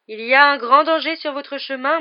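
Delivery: high, loud, theatrical warning; alarmed seer. Acted. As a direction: cry out the danger like a fortune-teller. Seer warns of great danger.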